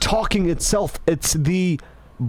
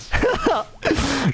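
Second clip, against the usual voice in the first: high burst of laughter; 8 semitones higher than usual. Real, not acted. After joking about eye contact, a high laugh.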